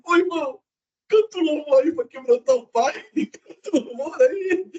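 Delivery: paródia aguda de crente choroso; peça o louvor num choro fingido, bem agudo; súplica chorosa. Retold or imitated. (súplica chorosa caricata, voz aguda)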